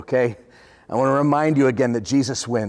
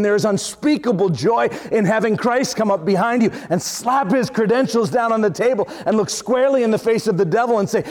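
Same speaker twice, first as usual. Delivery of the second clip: raised, driving preacher's voice; preaching at full force; 5 semitones higher than usual. Acted. Pastor rousing his congregation, preaching at full force. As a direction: preach it out to the whole church.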